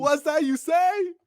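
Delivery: high, loud, drawn-out quoted shout; mock shout. Quoting the Virgil chicken line to friends, shouting it. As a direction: belt out the catchphrase in mock outrage.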